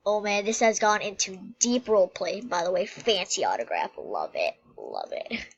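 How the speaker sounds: usual speaking voice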